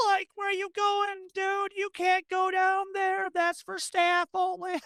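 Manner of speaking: high, incredulous bystander voice; alarmed calling out. Acted. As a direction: call after someone running where they shouldn't. Background staffer calling after runner.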